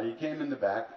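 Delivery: usual speaking voice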